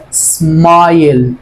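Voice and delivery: سمايل stretched very slowly, the "aay" held long; a teacher drawing out سمايل word by word; slow teaching pronunciation. Shown as a demonstration. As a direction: model سمايل slowly so learners hear the long I. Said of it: "الكلمة اللي بعديها سمايل".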